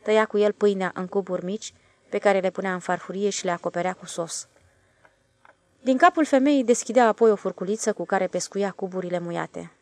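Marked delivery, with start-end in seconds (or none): none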